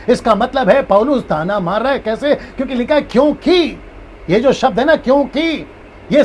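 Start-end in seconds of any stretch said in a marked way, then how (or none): none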